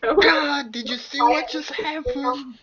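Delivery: high, loud mock-excited voice. Player mimicking the excited dreamers at the table, pretty loud. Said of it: "you said that pretty loud".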